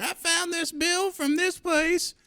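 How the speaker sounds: high, slow grandma voice; old-lady phone voice. Retold or imitated. Grandson imitates grandma reporting a bill, high and slow. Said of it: "be like".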